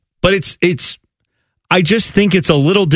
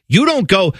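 usual speaking voice

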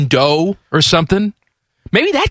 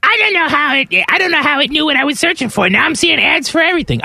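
high, fast mocking girlfriend voice; squeaky imitation of a confused wife; imitating Kristen; 11 semitones higher than usual. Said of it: "'Cause Kristen's all like".

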